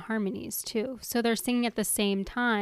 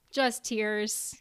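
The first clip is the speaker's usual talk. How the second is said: normal talking voice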